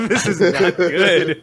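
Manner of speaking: loud, high, laughing groan of dismay. Real, not acted. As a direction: say it through helpless laughter. Laughing at a bad roll.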